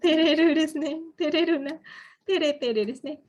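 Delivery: blushing, embarrassed reply. Shown as a demonstration. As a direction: act flustered by the praise. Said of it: "Ini memang harus ekspresif".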